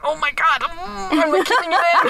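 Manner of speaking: high, giggly held-in excitement; suppressed squeal. Retold or imitated. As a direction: squeal but try to keep it in. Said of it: "and I was like".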